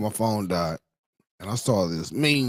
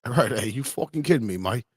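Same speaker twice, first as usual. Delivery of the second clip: mock British accent, pitched up; 3 semitones higher than usual. Streamer reading a British people meme aloud, mock British accent. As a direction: put on a cheeky British accent. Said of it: "British people be like".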